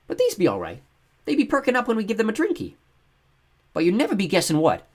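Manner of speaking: put-on high, loud childish chatter; excited boy Matt. Matt telling Kira and Thomas, excited. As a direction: rattle on like an eager little boy.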